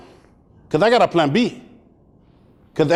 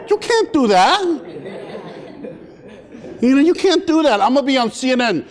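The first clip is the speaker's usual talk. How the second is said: high-pitched, pleading objection; mock-desperate, high-voiced begging; panicked protest; 4 semitones higher than usual. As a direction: plead like a kid in danger. Panicking boy protests being left alone.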